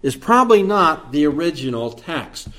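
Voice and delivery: normal talking voice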